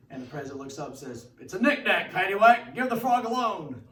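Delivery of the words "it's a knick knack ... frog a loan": loud, high sing-song punchline; booming punchline. Acted. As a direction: belt out the punchline with glee. Joke punchline, president booming.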